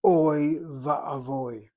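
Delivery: loud, slow "Oi va'avoy" with a long held "Oi"; drawn-out, emphatic "Oi" in "Oi va'avoy"; oi combination. Shown as a demonstration. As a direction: stretch the "Oi" diphthong, then finish "va'avoy". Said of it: "the oi combination".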